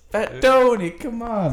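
high, loud, hammed-up New York Italian; mock mobster voice. Friend teasing him, loud mock Italian mobster voice. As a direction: play a cartoon wise guy.

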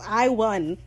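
normal talking voice

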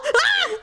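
high startled cry mimicking him; startled yelp. Imitating Daryl jolted awake, high yelp. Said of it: "He's all".

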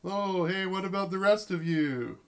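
playful shout from the tub; man calling from bathtub. Acted. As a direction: call eagerly through to the next room. Brad calls from the tub.

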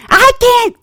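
high, loud mimicked old-lady holler; talking too loud. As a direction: holler like someone hard of hearing. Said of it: "they're talking loud".

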